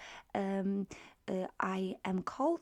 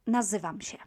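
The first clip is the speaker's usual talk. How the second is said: "Nazywam się" as one quick word, ending "sheh"; faster than usual. Shown as a demonstration. Teacher repeating the quick form, rapid "Nazywam się". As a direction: say "Nazywam się" fast, nasal tail cut. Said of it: "very quickly".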